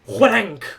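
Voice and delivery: loud imitated metallic clang; loud impact noise. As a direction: punch out the sound of a blow. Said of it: "you just hear a loud".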